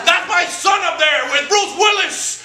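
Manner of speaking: slow, loud, high proud shout; proud dad shouting. Retold or imitated. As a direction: bellow it to the whole theater. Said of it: "He stood up ... and went".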